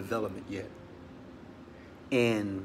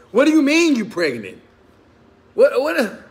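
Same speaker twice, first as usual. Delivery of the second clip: much higher, louder, panicked disbelief; shocked outburst; 10 semitones higher, 8 dB louder than usual. Retold or imitated. A man reacting to news of another baby, in a shocked yell. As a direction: yelp it like the floor just dropped out. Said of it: "You ever said that?".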